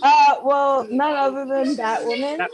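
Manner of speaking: playful red carpet announcer voice; presenting her outfit like a star; red carpet reveal. Pretend. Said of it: "pretend like it's the red carpet".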